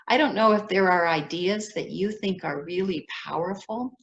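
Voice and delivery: usual speaking voice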